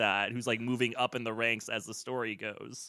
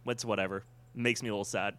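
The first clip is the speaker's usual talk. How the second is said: ordinary delivery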